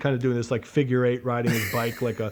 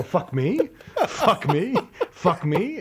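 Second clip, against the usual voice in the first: higher, rising, building disbelief with laughter; mock incredulous self-talk; 7 semitones higher, slower than usual. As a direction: act out working yourself up, question by question. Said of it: "I kept whispering. I said to myself".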